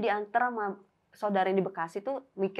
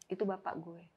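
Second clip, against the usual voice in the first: soft, subdued confession; retold quiet revelation; 9 dB quieter than usual. Friend reveals who the dream man is, hushed. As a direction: let it drop softly, imitating the friend.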